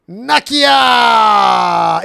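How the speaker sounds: loud excited call of a name. Real, not acted. Host calls out to guest.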